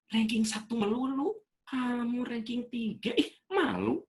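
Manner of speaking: put-on a mother shaming her child with the neighbour's kid; disappointed nagging mother. Mother comparing her child to Tante Wiwi's son, high and shaming.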